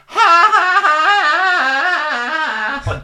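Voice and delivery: high, slow villain laugh; evil cackle. Acted.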